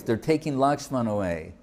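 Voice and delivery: ordinary delivery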